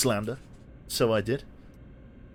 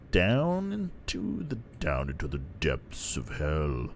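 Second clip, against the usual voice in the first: ominous; 7 semitones lower, 6 dB quieter, slower than usual. Pretend. Mock-ominous descent into tunnel.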